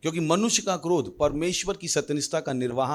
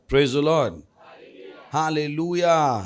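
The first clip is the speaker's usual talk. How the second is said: rousing call; 4 dB louder, much slower than usual. Real, not acted. Preacher calls congregation.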